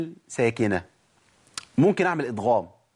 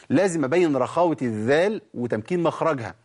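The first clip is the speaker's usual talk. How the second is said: usual speaking voice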